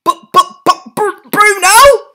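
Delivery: high, loud stuttering; shocked father; stammering. A narrator's reading. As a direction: trip over the words. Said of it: "stammered Mister Jenkins".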